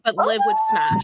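high, thrilled squeal of approval; excited squeal. Real, not acted. Player squealing that her character would smash. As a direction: squeal it out, totally sold. Players joking about the hag.

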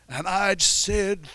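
slightly high mock pulpit flourish, slow; a hint of gusto preaching. Pastor imitating a preacher's line, a hint of gusto. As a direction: lightly ham up the preacher's gusto. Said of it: "preach one time with gusto".

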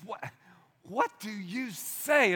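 usual speaking voice

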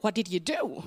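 ordinary talk

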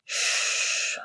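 a gentle whispered shh; tender shushing whisper; soft hushing. A narrator's reading. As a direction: hush him softly, no voice. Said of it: "Remus hushed".

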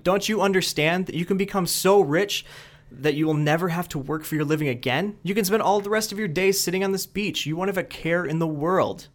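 a hammed-up angry rant; mock shout. Businessman shouts at fisherman, mock angry yell. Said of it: "he shouts at the fisherman".

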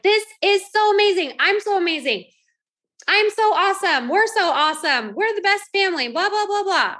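high, gushing, smug delight; mock self-praise. Mother bragging about kids doing laundry, smug gushing. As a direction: preen over your own genius, hammed up. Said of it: "And I was like".

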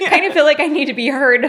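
high, loud, laughing plea; laughing protest. Real, not acted. Client recalls pleading to be heard by therapist, laughing protest. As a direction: protest through laughter, pitched well up.

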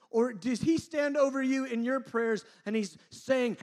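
high, very loud preacher's voice; impassioned preaching. Real, not acted. Preacher urging a torn soul to know God, impassioned. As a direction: preach it at full force.

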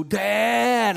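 mock anxious teen son. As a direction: play the son pleading up high. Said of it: "I'm like".

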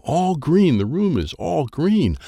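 plain reading voice

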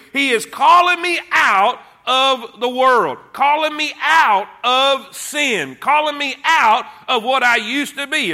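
rising preacher's cadence, driving each line; preaching at full force. Acted. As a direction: hammer the repeated phrase home.

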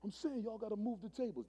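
high, insistent retold demand; worked up. Gang leader demanding the pastor move, mock worked-up. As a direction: imitate an agitated gang leader, without shouting. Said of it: "He's really worked up".

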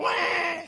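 high mock tantrum cry; drawn-out, high-pitched fit noise; imitated toddler wail. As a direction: imitate a little child's angry "wah". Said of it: "She bows up".

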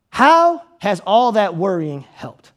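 drawn-out, deliberate rhetorical question; slow probing preacher question; slow pointed question. Acted. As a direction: let each word land slowly.

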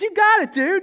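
high, loud buddy-style praise, voicing Jesus; casual excited approval. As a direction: cheer him on like an old pal.

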